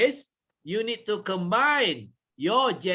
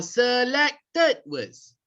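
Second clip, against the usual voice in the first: "selected" drawn out and punched; stress on SELECTED; 6 semitones higher, much slower than usual. Shown as a demonstration. Trainer showing how to raise volume on key words, stressed "selected". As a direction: punch each syllable of "selected". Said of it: "increase the volume of your voice".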